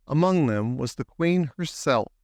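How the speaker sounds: ordinary delivery, nothing marked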